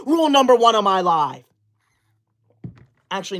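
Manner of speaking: ordinary delivery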